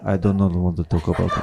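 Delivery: put-on low, fast mumbled Russian accent with a laugh; gloomy Russian accent. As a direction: rush out a sullen Russian reply. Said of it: "it's layered in the accent".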